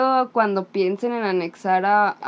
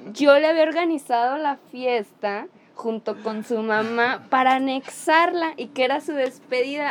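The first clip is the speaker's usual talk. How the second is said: usual speaking voice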